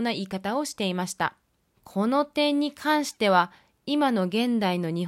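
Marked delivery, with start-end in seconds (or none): none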